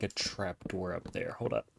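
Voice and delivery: ordinary talk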